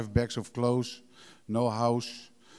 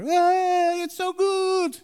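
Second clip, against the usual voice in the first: much higher, louder gushing mock enthusiasm; cheery newcomer; 7 dB louder, much slower than usual. Sponsees in their first year, gushing mock cheer. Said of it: "in the first year they are".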